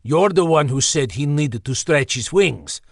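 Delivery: put-on Slavic-accented griffin accusing. Ixter blaming Bernie mid-quarrel, Slavic accent. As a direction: blame him in a Slavic accent. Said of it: "in his Slavic accent".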